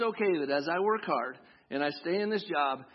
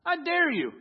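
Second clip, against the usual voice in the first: loud, high dare in God's voice; booming, daring challenge; bold challenge; 11 semitones higher, 5 dB louder than usual. Retold or imitated. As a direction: throw down the gauntlet with a grin. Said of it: "he's like".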